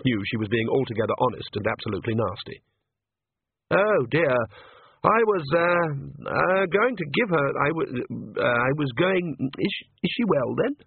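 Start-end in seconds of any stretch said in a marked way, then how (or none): 3.7-10.8 higher, hesitant priest voice; nervous young priest. Acted. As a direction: stumble politely, a bit anxious. Priest flustered, stammering.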